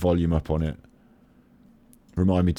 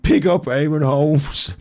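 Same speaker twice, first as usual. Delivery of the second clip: high, drawn-out mock shout; imitated interrupting shout; 7 semitones higher, 4 dB louder, slower than usual. Imitating Luke butting in on an interview, drawn-out shout. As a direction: shout it out slowly, cutting in. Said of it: "Luke interrupts by shouting".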